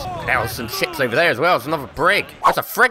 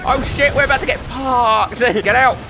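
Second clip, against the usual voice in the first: panicked yelling; 8 semitones higher than usual. Real, not acted. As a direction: hurry everyone out in a panic. Under mortar fire, fleeing.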